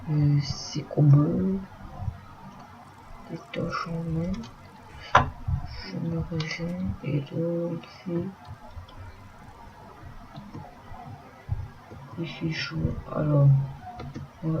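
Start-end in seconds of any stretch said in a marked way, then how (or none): 3.3-12.8 speaking softly. Real, not acted. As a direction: keep it soft, as if not to wake anyone. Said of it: "excusez-moi si je parle doucement".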